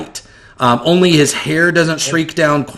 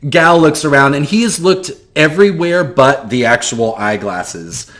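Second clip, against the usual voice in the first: normal talking voice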